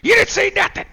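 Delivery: put-on a tiny kid barking a threat way up high; squeaky kid threat. Little kid threatening the head cook in a loud squeaky voice.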